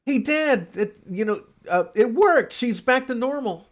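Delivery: high, loud, cheering; a kid calling to dad; mock excited son. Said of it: "Terry goes".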